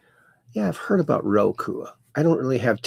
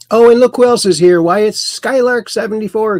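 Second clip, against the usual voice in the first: high, loud, showy welcome; playful surprise; 8 semitones higher, 7 dB louder than usual. Pretend. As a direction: burst out with a played-up discovery of a guest. Host greets another arriving viewer.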